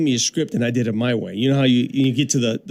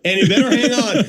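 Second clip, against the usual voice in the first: high-pitched, bossy train announcer; own train announcement; 8 semitones higher than usual. Shown as a demonstration. Candidate redoes his audition for the train voice, bossy high announcement. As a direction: order riders around, stretched out and raised in pitch. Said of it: "here's how I did it".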